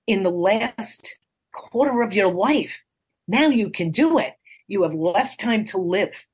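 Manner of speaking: usual speaking voice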